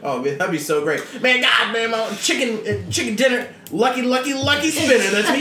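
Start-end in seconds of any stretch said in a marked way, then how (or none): 1.2-5.4 much higher, louder cackling mock Manson; sing-song brag like a crazy inmate; hammed-up Manson rhyming in his cell; Charlie Manson impression.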